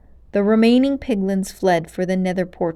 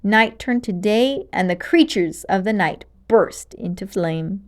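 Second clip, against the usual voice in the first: ordinary delivery, nothing marked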